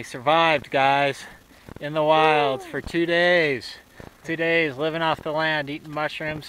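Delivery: loud, proud announcement; triumphant. Real, not acted.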